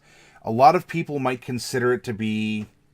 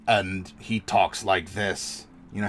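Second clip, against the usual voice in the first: deep dwarf voice; 4 semitones lower than usual. Shown as a demonstration. DM showing players how a Mixla dwarf talks, low and slow. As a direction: lower and slow, a rough little dwarf rumble. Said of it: "has this very deep voice".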